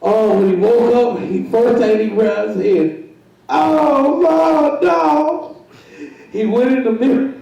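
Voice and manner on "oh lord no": higher, lightly hammed-up dismay; a touch of mock crying, higher; mock wail. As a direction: imitate him wailing at the mirror, pitched up. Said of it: "he's crying".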